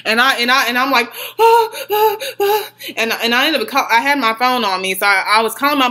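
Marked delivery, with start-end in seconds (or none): 1.4-2.7 higher, fading ah-ah-ahs; whimpering cries. Retold or imitated. Told to calm down, still crying out higher. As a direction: cry out, then let it sink. After being threatened with arrest.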